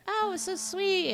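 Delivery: high-pitched imitation of a gushing mom; mom's gushing. Retelling mom's reaction to the live action one, gushing. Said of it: "and she's like".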